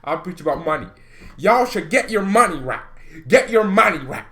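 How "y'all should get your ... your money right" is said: loud, high preaching; preacher. Pretend. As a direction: preach it with full force. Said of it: "I would probably be a preacher".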